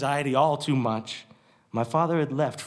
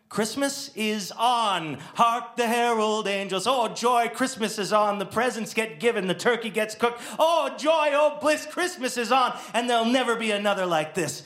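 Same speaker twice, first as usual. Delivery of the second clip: rapturous Christmas joy, higher; jubilant; 9 semitones higher than usual. Acted. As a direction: sing out the news like a Dickens ending. Overjoyed news Christmas saved.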